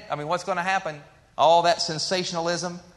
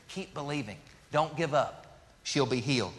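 softer, tender encouragement; encouraging Jesus; 4 semitones lower, 6 dB quieter than usual. Acted. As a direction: lift up a grieving father gently. Jesus lifts up grieving father.

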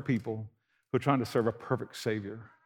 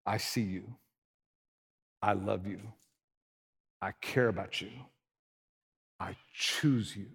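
tender God voice; 5 semitones lower, 6 dB quieter than usual. Retold or imitated. God telling people he chooses them, soft and tender. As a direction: speak gently as God to each person. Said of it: "for him to say".

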